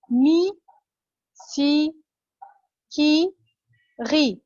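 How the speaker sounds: "مي، سي، كي، غي" each ending in a sharp ee; isolated drill syllables "مي، سي، كي، غي", said mi, si, ki, ri; teacher's ee drill on "مي، سي، كي، غي", spaced out. Shown as a demonstration. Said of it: "في فرق بين ال إيه وال إي".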